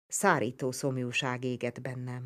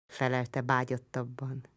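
plain reading voice

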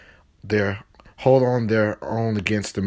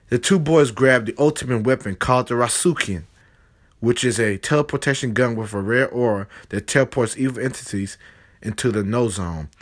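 plain reading voice

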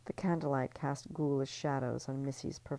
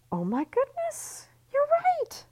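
slow, much higher mock surprise; fake delight; 4 dB louder, slower than usual. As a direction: gush falsely, as if thrilled. [name] fakes delight.

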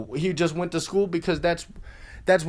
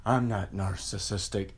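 mock denial; 8 semitones lower, 6 dB quieter than usual. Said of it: "Even if you're like".

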